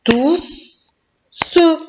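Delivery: syllable-by-syllable "Tousse … se"; split into syllables. Shown as a demonstration. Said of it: "fais le découpage syllabique du mot tousse".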